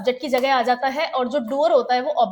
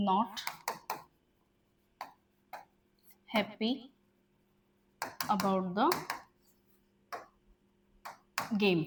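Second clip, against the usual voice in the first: slow dictation; 4 semitones lower, 11 dB quieter, much slower than usual. Shown as a demonstration. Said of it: "dictating word by word".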